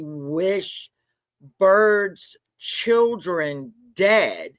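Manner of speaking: drawn out, word by word; appalled, stretching every word; slow outrage. Real, not acted. Streamer again repeating the wish, slow and appalled.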